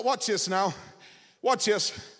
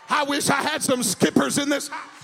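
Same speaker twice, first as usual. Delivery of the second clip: full-force preaching; 4 semitones higher than usual. Acted. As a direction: rouse the church to praise. Preacher builds to a climax.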